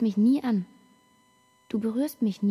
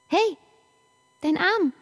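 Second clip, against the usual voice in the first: startled call; 8 semitones higher, 4 dB louder than usual. Acted. Sudden alarm at slipping arm.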